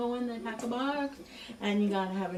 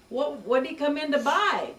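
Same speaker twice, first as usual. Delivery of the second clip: higher and louder, unable to believe it; stunned disbelief; 7 semitones higher, 8 dB louder than usual. Retold or imitated. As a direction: blurt out the question in disbelief. Manager stunned by the answer.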